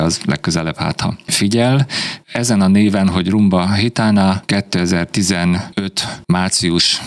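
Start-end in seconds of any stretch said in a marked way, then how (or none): none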